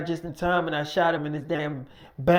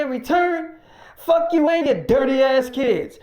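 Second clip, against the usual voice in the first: loud, higher furious tirade; furious rant; 5 semitones higher, 4 dB louder than usual. Retold or imitated. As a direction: unload all your anger on him. Mike raging at the worker.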